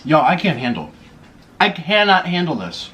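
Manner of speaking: ordinary delivery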